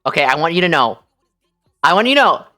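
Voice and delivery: ordinary talk, nothing marked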